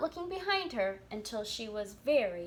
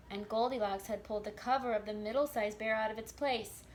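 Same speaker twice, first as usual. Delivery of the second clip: ordinary delivery, nothing marked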